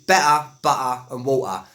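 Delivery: Cockney glottal stops in "better, butter and water"; Cockney accent. Shown as a demonstration. Teacher showing learners Cockney words, Cockney accent. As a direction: drop the T's like a Cockney. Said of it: "with a Cockney accent".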